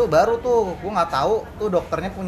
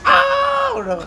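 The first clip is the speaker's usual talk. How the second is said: put-on piercing high-pitched scream of a child; child's scream; 6 dB louder, much slower than usual. Teen boy at his circumcision screams in terror. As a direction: scream like a scared kid. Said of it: "Nah, teriak, Bro".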